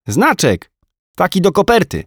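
put-on high, chirpy little boy; small boy reporting his cartoon to his mum; child voice. A narrator's reading. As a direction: tell it like a proud small kid.

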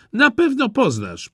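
put-on high, eager little girl. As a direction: chirp it like a small girl. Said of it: "powiedział Czerwony Kapturek".

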